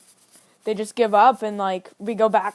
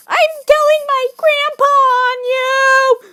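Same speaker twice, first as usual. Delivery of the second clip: very high, loud, slow mock wail; screechy little tattletale impression; crying tattletale kid; 10 dB louder, slower than usual. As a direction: drag out a hammed-up falsetto kid's threat. Retold beaten kid threatens to tell.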